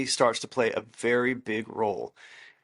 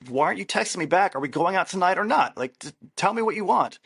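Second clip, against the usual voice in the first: higher, pushy and impatient; upset texter; 7 semitones higher than usual. Retold or imitated. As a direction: demand an answer, mock-upset. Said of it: "Key is getting really upset".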